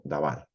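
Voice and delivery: "davar" read with two clear a sounds, da-VAR; reading Hebrew davar aloud to students; Hebrew reading demo. Shown as a demonstration. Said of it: "la vamos a poder leer, por ejemplo, como".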